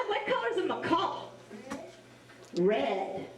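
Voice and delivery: plain reading voice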